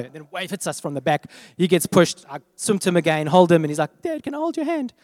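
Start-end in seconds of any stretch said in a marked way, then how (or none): 4.0-4.9 put-on higher, small child's plea; little boy voice. Son asks dad for his hand, higher childlike plea. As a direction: voice the son asking softly for help.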